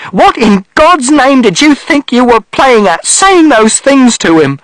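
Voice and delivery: high, loud, slow furious shout; drawn-out outraged accusation; mother shouting. A narrator's reading. Mother shouting at father for what he said, outraged. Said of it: "And mother shouted".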